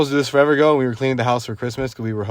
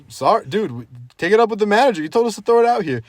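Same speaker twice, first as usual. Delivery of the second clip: higher, quick pleading explanation; retold protest; 9 semitones higher, 4 dB louder than usual. Storyteller retelling their excuse to the dump owner, hurried protest. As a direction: push back hurriedly in a raised pitch.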